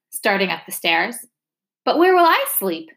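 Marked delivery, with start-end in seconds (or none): 1.8-2.9 put-on high, louder boy's wail. A narrator's reading. Boy wails to the doctor about losing his room, high and loud. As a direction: wail it like a child about to cry. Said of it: "Torrin wailed".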